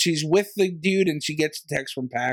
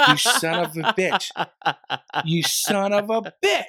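laughing mock insult; 3 semitones higher than usual. Real, not acted.

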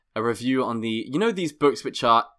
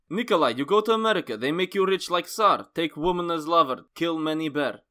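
put-on higher, urging Russian accent; 5 semitones higher than usual. Brother urging Nikolai to America, Russian accent. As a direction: urge him on in thick Russian English. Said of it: "he say to me".

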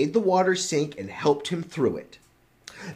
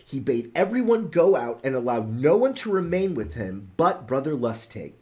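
ordinary delivery, nothing marked

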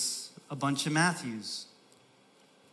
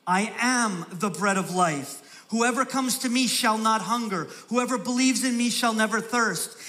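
raised, ringing pulpit voice; full-force preaching; 6 semitones higher, 4 dB louder than usual. Acted. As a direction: proclaim it to the whole church.

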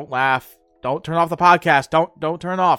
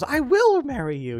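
much higher, mock swooning girlfriend; high mock-girly proposal; girlfriend in a commercial; 9 semitones higher than usual. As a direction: play the girlfriend in a silly ad, pitched way up. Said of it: "some woman, apparently his girlfriend, goes".

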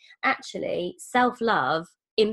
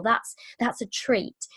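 whisper; slower than usual. Pretend. Quoted food talk, whispered.

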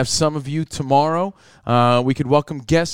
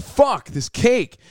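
high, slow, drawn-out curse; mock exasperation; 8 semitones higher, much slower than usual. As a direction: stretch it out in play-acted frustration. Acting out daily exasperation at cake.

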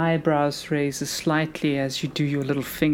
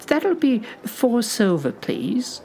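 put-on higher old shopkeeper voice; very old woman; 5 semitones higher than usual. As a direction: ask sweetly like a granny. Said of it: "a very old lady".

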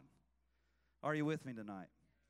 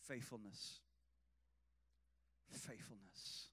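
soft, much quieter, low; hushed emphasis; 8 semitones lower, 14 dB quieter than usual. Real, not acted. Pastor quietly stressing faithfulness to the church. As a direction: let the word sink in softly. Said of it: "whispered single word".

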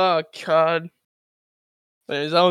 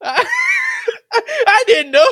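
drawn-out high squeal through laughter; loud, slow falsetto excuse; hammed-up squeaky protest; mock innocent; 6 dB louder, much slower than usual.

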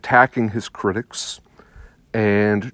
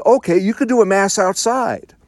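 a higher, dismissive mock voice for the officials; 8 semitones higher than usual. Priest mimicking the bishops who tell clergy to say mass outside, mocking. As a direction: sneer it as the bishops' condescending permission.

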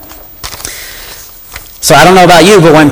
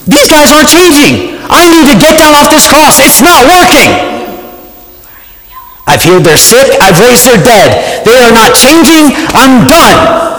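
much higher, fed-up rant; exasperated outburst; 12 semitones higher than usual. Pretend. Preacher imagining himself on the cross, exasperated and fed up. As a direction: throw up your hands, totally done with them. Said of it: "If it were me, it would have been".

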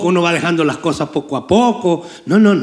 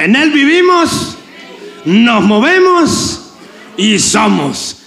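high, slow, fervent declaration; with faith; 5 semitones higher, slower than usual. Shown as a demonstration. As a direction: stretch each word out like a proclamation. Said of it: "dígalo con fe". (con fe, alto y lento)

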